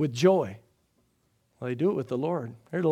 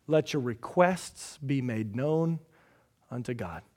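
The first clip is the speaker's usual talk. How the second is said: much softer and slow, almost hushed; soft, slow scripture; 5 semitones lower, 8 dB quieter than usual. Acted. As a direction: savor each word of the verse. Scripture read softly, slowly.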